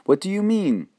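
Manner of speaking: put-on high, startled boy asking; sudden frightened question; alarmed question. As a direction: jump up in alarm at the hint. Boy shocked by mother's hint.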